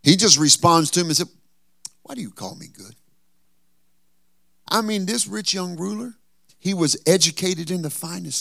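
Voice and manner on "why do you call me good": quiet question. Acted. Jesus answering quietly.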